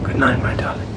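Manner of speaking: soft whispered good night; tender whisper. Acted. Rochester bidding Jane good night at her door, whispering. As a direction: hush it lovingly.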